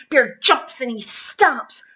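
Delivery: ordinary delivery, nothing marked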